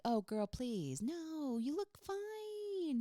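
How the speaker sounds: high, drawn-out gushy protest; mock reassurance. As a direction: coo an exaggerated "no, you look fine" to a friend. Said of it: "helping them by being like".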